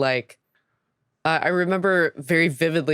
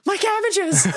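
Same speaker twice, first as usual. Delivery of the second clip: high-pitched wail of mock anguish; Cabbage Man. Quoting a cartoon character to co-hosts, high mock-anguished cry. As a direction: cry out far above your own voice, hammed-up. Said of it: "he's also Cabbage Man in".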